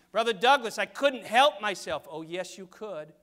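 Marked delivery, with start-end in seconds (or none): 0.1-2.1 loud, higher protesting voice; hammed-up loud excuse-making; mock excuse. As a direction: plead helplessness loudly, higher pitched. Acted excuse of a church member.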